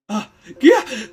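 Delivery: loud high yelp of horror; old man's loud cry of horror; horrified cry. Acted. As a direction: recoil with a scream. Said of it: "recoiled in horror".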